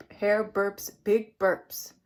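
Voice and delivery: ordinary delivery, nothing marked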